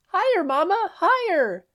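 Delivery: put-on very high excited child's voice; little girl. A narrator's reading. Little Eri calls to her mom from the swing, high and gleeful. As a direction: squeal it like a small girl on a swing.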